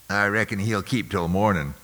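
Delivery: a touch of a sullen overseer grumble; sullen mutter. Acted. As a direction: overseer grudgingly answering the Major, sullen. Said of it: "Claxton muttered, sullen".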